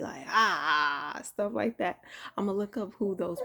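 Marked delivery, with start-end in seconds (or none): 0.3-1.2 loud mock battle cry; imitating island women going crazy; wild yell. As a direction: let out a wild warrior cry. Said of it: "they go crazy".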